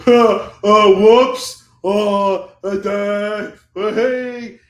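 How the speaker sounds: loud, high mock oafish outburst; mock klutzy yelp. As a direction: imitate a clumsy guy fumbling, loudly. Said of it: "and he was like".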